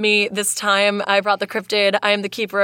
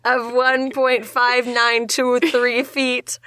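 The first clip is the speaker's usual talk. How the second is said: stifled laughter; 2 semitones higher, much slower than usual. Real, not acted. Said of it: "very mathematically articulate lumberjack".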